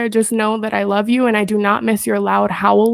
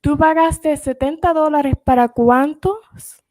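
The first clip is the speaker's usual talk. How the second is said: Spanish; 4 semitones higher than usual. Real, not acted. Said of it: "And you speak Spanish too?".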